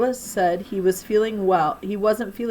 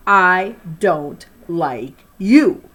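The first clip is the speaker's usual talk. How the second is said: slow, loud outburst of rejection; exploded; 9 dB louder, much slower than usual. A narrator's reading. Girl exploding at the boy who likes her. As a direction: blurt it out hard and slow. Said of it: "I exploded".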